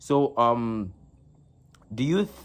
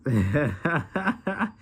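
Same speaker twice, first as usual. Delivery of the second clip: amused laugh; 3 semitones higher than usual. Real, not acted. Co-caster greets an excited guest, amused laugh. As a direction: chuckle in a rising run.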